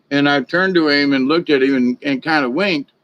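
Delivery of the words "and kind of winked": normal talking voice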